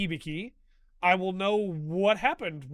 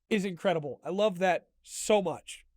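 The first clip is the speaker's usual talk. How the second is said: ordinary talk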